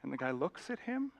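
normal talking voice

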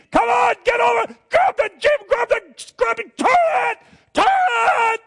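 high, loud frantic yelling over the storm; panicked shout. Acted. Sailors shouting over the storm, frantic and loud. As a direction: bellow orders as the boat sinks.

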